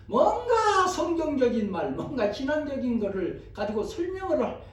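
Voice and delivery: high, strained-for-words mock fluster; flustered churchgoer. Voices a flustered would-be evangelist. (높은 목소리로 쩔쩔매는 척)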